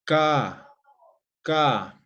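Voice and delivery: "Ka" from the back of the mouth, loud; velar ka. Shown as a demonstration. Teacher demonstrating velar sounds to the class, loud. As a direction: bark "ka" from the throat, twice.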